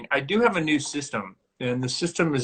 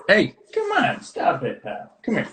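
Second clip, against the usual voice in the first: sudden high call to a pet, easing down; scolding a dog; 6 semitones higher than usual. Real, not acted. Host interrupted by the barking dog, scolding. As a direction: break off to chide the dog.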